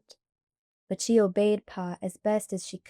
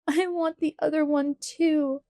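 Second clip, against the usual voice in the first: loud sobbing; 6 semitones higher than usual. A narrator's reading. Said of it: "Laura sobbed loudly".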